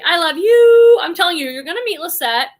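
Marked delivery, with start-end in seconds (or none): 0.0-1.0 high, loud, drawn-out "you"; gushing affection. Real, not acted. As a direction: gush it loudly, sustaining the last word high. Gushing goodbye to a departing viewer.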